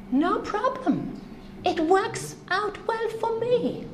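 high, breezy voice of a careless viewer; an easygoing teen shrugging it off, well up in pitch; mock carefree moviegoer. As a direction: toss it off like nothing matters, pitched high.

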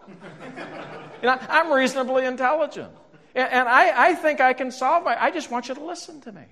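high, insistent, exasperated plea; imitated wife going off. Wife wants husband just to listen, mock exasperation in a high voice. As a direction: play the wife at full tilt, pitched right up. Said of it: "then she really gets going".

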